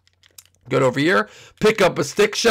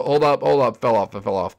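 ordinary talk, nothing marked

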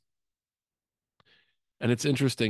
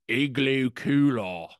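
confident mock Australian, slow and raised in pitch; higher, drawn-out Australian accent imitation; 4 semitones higher, 4 dB louder, slower than usual. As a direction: stretch it out in full Australian. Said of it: "an Australian accent".

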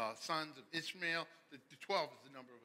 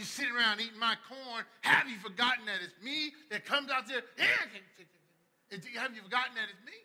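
loud, high, fired-up preaching; loud animated sermon; full-force preaching; 10 semitones higher, 20 dB louder than usual. Acted. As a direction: preach it big and loud to the crowd.

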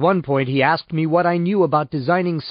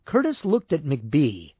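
ordinary delivery

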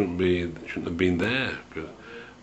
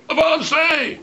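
very high, loud imitation of a sergeant's order; shouted command; 6 dB louder than usual. Riot police sergeant ordering his men forward, shouted command. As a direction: shout it out as a charge order to the ranks. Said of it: "shouted out".

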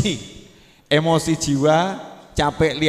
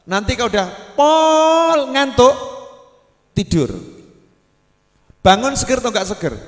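usual speaking voice

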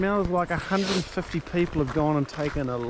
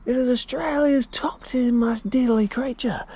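high, excited Australian wildlife host; hammed-up croc hunter voice; Steve Irwin impression; 8 semitones higher, 4 dB louder than usual. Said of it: "Give us a Steve Irwin".

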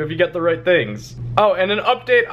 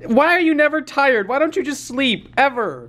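pet talk; 8 semitones higher than usual. Real, not acted. Baby talk to puppy.

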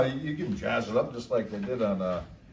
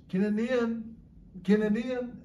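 Kinanean sung out high and bright; classroom call; 11 semitones higher than usual. Shown as a demonstration. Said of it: "I'll go like this".

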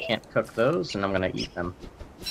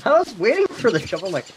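high confused voice; 11 semitones higher than usual. Retold or imitated. Voicing his waiting character.

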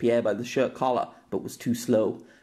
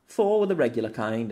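ordinary delivery

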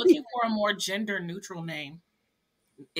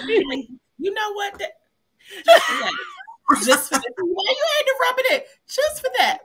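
mock indignant; 14 semitones higher, 8 dB louder than usual. Mock revenge over spoilers.

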